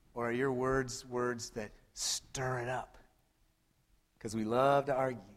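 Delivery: whisper. Real, not acted. Preacher whispering a pointed question to the congregation.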